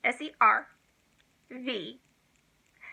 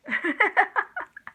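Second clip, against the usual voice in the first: high giggle; 8 semitones higher than usual. Real, not acted. Laughing at her own mistake.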